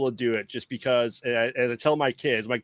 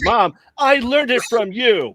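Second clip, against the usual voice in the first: put-on slow, higher, a touch of the guilty kid; mock kid line; 8 semitones higher, 5 dB louder, slower than usual. Friend quotes the kid's retort to his mom, slow and high. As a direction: draw it out like the sulky kid in the ad. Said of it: "their correct response is".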